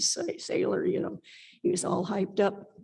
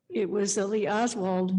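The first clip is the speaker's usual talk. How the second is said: ordinary talk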